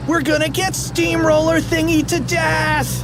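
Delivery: high-pitched wail of doom; panicked mock scream. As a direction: squeal it all the way through. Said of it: "screamed Fluffy".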